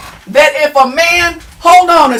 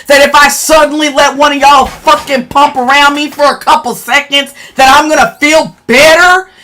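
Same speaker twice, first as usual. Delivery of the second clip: louder, heated, cursing tirade; angry rant; 3 dB louder than usual. Real, not acted. Woman ranting at a troll in her chat, loudly furious. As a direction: tear into the commenter, furious. Ranting back at a hostile commenter.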